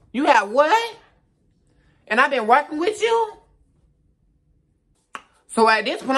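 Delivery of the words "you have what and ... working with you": high, stunned double-take; shocked disbelief. Retold or imitated. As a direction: react like you just heard terrible news. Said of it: "So I'm like".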